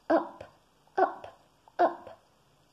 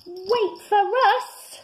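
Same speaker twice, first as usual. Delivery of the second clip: much higher, louder, slow call; 12 semitones higher, 9 dB louder, slower than usual. Acted. Rabbit and frog call after the running bear. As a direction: shout after him. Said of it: "cried Lippity Rabbit and Very Small Frog".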